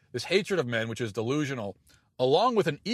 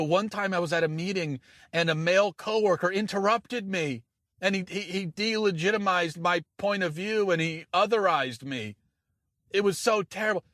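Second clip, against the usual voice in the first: higher, whiny mock of a complaining feminist; mock sob story; 5 semitones higher than usual. Host mocking feminists' office complaints, hammed-up whine. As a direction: parody a self-pitying coworker story. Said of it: "they'll tell these sob stories".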